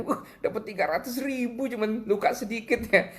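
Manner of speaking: high-pitched imitation of happy amazement; delighted. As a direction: play someone thrilled at a windfall. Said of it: "Dia senang sekali kelihatan dari mukanya".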